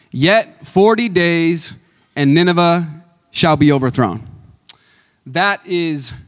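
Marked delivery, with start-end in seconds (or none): 0.1-4.3 slow, loud prophetic proclamation; passionate cry. Acted. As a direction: cry it out to the whole city. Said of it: "and he cried, so he did it passionately".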